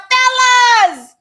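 high, loud call across the water. Acted. Jesus calling from the beach to the disciples, high and loud. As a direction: hail the boat from the shore. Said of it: "He called out".

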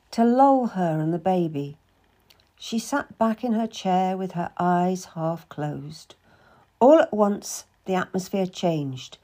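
plain reading voice

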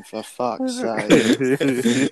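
very slow, drawn-out "For fuck's sake"; weary curse on waking to the porter; exasperated groan. Retold or imitated. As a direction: drag it out, fed up. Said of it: "I was thinking".

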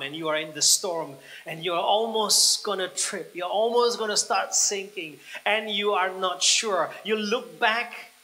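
loud preaching, building to a high shout; impassioned preaching. Acted. Preacher to believers tempted by the safety boat, shouting with passion. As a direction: build from forceful to full-throated, high-pitched exhortation.

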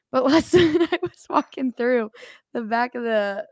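ordinary talk, nothing marked

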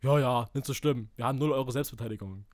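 low, dry, unbothered reply; bone-dry dismissal in a low voice. Retold or imitated. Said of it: "sagt er so ganz trocken".

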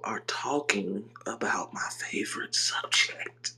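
soft unvoiced whisper on the phone. Real, not acted. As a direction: whisper it, just woke up. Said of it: "Why you whispering?".

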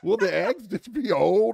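laughing through the question. Real, not acted. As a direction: giggle out a slow high question.

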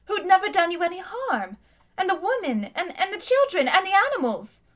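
put-on a young girl's indignant plea; upset girl. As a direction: protest for the innocent people. Polly pleads for the people.

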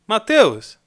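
Mateus barked loud, like a parent scolding; angry shout. Shown as a demonstration. As if his father scolds him, a loud angry shout. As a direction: yell the name like you are in trouble. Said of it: "alguém me chamar desse jeito, o que que aconteceu?".

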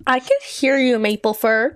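loud indignant call across camp; shouted. Acted. Pineclaw calling back at Maplefur, shouted. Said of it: "Pineclaw shouted".

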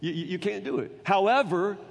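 ordinary talk

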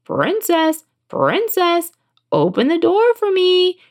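high, drawn-out frog plea; frog calling out. A narrator's reading. Frog knocks a second time, high calling voice. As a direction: sing out through the door as a frog.

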